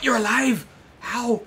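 high, loud disbelief; incredulous outburst. Real, not acted. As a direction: yell questions in baffled shock. Enemy survives unexpectedly.

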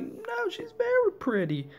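very high, badly faked compliment; insincere flattery. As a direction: lie through it in a squeaky-high voice. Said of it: "Totally lying".

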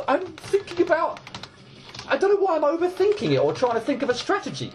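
high, exasperated gabble; quick, squeaky frustration; slightly pissed off. Real, not acted. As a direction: rattle it out high, annoyed at yourself. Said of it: "I am getting slightly pissed off".

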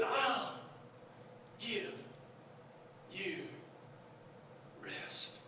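one word at a time with long pauses; quiet, drawn-out quote; slow, hushed promise. Retold or imitated. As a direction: soften and let each word hang. Slow quoted promise after shouting.

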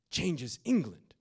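preacher's triumphant echo; proclaimed. Acted. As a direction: hit it hard and slow. Dramatic repeat at full force.

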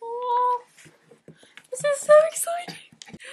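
high and loud, bursting with excitement; thrilled. Real, not acted. Opening the bag she won, high loud excitement.

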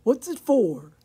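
loud, lightly grunted question; grunting pig. A narrator's reading. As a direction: ask with a hint of a pig's grunt. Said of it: "grunted Pig".

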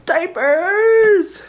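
high, drawn-out mock cheer; hammed-up big reveal. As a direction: announce it like a grand prize, high. Mock-dramatic reveal of the shopping.

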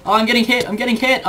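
panicked yelling. Real, not acted. Being attacked in game.

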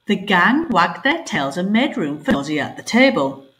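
plain reading voice

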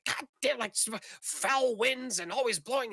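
put-on high-pitched cranky old woman ranting; irate old woman fuming aloud; yelling and cursing. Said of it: "she's just yelling and cursing".

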